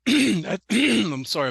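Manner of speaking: hoarse, strained voice clearing the throat; hoarse voice that keeps catching; losing voice. Real, not acted. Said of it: "I'm losing my voice".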